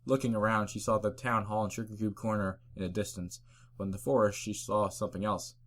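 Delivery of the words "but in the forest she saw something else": ordinary delivery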